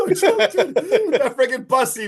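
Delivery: real high-pitched laughter through the words; laughing hard. Real, not acted. Host to co-host over the bus joke, laughing hard.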